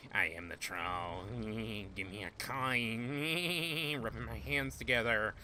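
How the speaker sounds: drawn-out troll voice with laughs; playful troll. Pretend. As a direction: cackle along as a greedy bridge troll. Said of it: "I can be a fucking troll".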